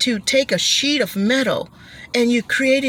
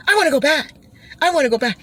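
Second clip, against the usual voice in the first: eager rider; 6 semitones higher, much faster than usual. Retold or imitated. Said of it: "You're like".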